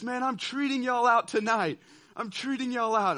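the coach hyping the team with a treat; excited coach. Retold or imitated. Coach promising dinner to his cheering players, excited and loud. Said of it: "And he says".

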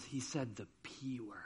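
put-on low, very soft child's confession; hushed tattling. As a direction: imitate a kid quietly reporting a forbidden word. Child tattling a forbidden word.

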